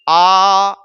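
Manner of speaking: आ held long and level as "aaa"; wrong vowel demo. Shown as a demonstration. Teacher asking students if it is said so, long-held vowel. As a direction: stretch आ wide open, steady and drawn out. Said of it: "ऐसे बोलेंगे?".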